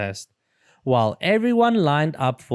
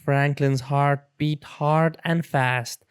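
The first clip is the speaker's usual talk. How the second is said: usual speaking voice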